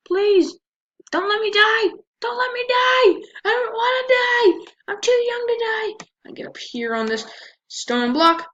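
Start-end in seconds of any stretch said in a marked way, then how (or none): 0.1-6.1 high crying shouts of mock terror; panicked pleading. Real, not acted. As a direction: scream for your life, hammed-up. Begging not to die in game.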